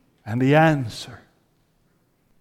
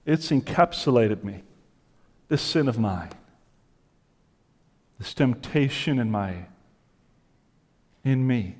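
hushed confessional sermon; 2 semitones lower, 4 dB quieter than usual. Acted. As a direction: confess it quietly as the trapped sinner. Voicing Paul's inner struggle, hushed.

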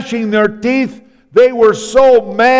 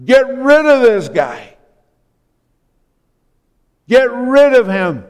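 raised-pitch urging, imitating an onlooker; retold goading cry, higher but not shouted; cheering them on; 4 semitones higher than usual. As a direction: egg the attackers on from the sidelines. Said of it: "who just cheered them on".

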